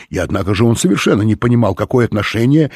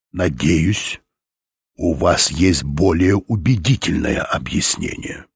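low menace; 3 semitones lower, slower than usual. A narrator's reading. Threatening step toward Sophie.

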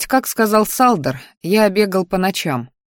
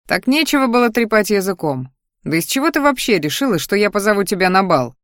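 ordinary delivery, nothing marked

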